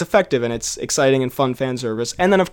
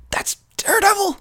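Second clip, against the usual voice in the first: excited retold exclamation; 3 dB quieter than usual. Leaning over to mom on spotting Daredevil, excited high squeal.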